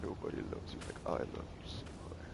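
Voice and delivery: creepy whispering yandere calling senpai; overacted anime dub. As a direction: obsessive sing-song stalker voice. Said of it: "like, yondeer".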